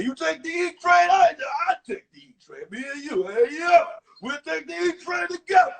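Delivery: loud, high and thrilled, Rocky-style; excited Rocky voice. Retold or imitated. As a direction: shout it with street excitement. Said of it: "he talked like Rocky".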